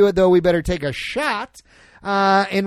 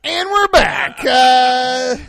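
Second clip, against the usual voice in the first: high, loud show-host return; announcer shout; 6 semitones higher, 8 dB louder, much slower than usual. Real, not acted. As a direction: boom it like a host back from break. Show host returning after derail.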